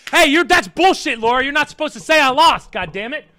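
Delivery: laughing, loud and high protest; hammed-up indignant yell; mock outrage. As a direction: cry foul at the viewer, half laughing. Protesting Laura's jab, laughing.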